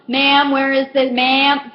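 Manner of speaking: demanding customer. Retold or imitated. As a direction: imitate a pushy shopper calling out. Impatient customer interrupts, calling the cashier.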